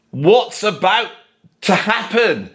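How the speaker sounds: usual speaking voice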